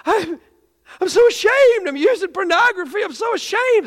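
loud, very high anguished wail; imitating weeping confession. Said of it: "He starts weeping".

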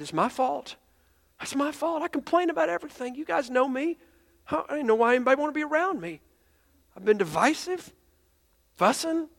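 high, pained, pleading confession; retold man owning his fault; imitating remorseful confession. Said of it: "He goes and he falls on his knees".

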